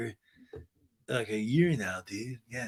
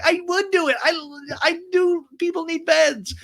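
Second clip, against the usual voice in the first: very high, loud mock insistence; squeaky hammed-up falsetto protest; Sheena; 6 dB louder than usual. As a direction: imitate a flustered woman defending herself. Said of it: "And she's like".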